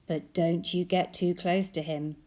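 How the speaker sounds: ordinary delivery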